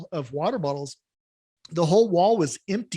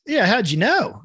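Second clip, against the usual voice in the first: put-on Kiwi accent in a joke; 5 semitones higher, 4 dB louder than usual. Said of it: "I have a Kiwi one".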